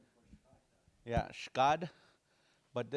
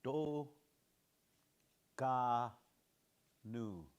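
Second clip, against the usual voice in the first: syllable by syllable; much slower than usual. Shown as a demonstration. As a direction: Do Ka nu broken into separate syllables with pauses. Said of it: "we're just gonna say".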